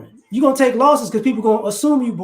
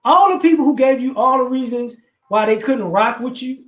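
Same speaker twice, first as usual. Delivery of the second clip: normal talking voice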